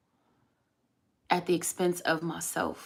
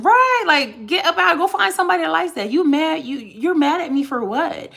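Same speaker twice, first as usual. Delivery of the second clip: loud, high-pitched rant; indignant; 10 semitones higher, 8 dB louder than usual. Real, not acted. Ranting at the troll who got mad at her, indignant. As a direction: tell them off at full voice, pitched way up.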